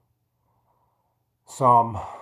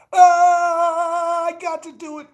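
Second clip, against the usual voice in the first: strained, slow, high push; holding a plank; 13 semitones higher, 6 dB louder, much slower than usual. Real, not acted. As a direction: force it out while holding a plank.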